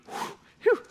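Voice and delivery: breathy relieved whistle; a quick sigh of relief; relieved whew. Real, not acted. Relieved the angry dad wants Luke. Relief it was not him.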